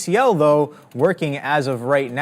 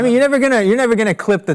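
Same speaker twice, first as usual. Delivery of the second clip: jumps much higher and louder to insist; emphatic excitement; 9 semitones higher, 5 dB louder, much faster than usual. Real, not acted. Host insists to co-host that the trade was great, with a sudden excited high burst. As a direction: cut in hard, keen to make the point.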